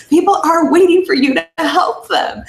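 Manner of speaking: high, loud, insistent yelling; retold yell. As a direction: yell it at her down the phone line. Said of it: "you almost yelling at me over the phone".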